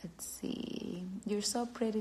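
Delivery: ordinary talk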